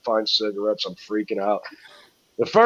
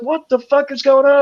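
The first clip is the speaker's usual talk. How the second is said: startled stranger; 14 semitones higher, 6 dB louder than usual. Retold or imitated. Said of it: "He's like".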